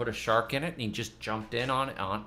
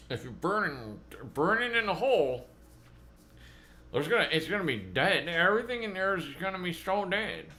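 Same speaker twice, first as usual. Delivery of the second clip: higher, whiny know-it-all critic voice; mocking critics; 4 semitones higher than usual. Mocking commenters who say burning kills soil life, whiny parody voice. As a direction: parody the naysayers' warning. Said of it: "for the people that were like".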